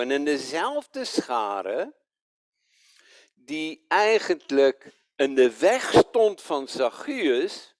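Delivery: ordinary delivery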